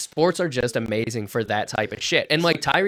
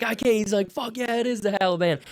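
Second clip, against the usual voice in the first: high, gleeful fan voice; pitched-up gleeful stranger; delighted fan; 10 semitones higher than usual. Retold or imitated. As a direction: play the stranger cracking up. Laughing fan quoted.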